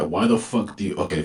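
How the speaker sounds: ordinary delivery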